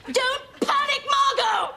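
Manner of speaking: high, loud frantic order; panicked shout. Acted. Wife telling the neighbour not to panic, panicked shout. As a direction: yell reassurance while panicking yourself.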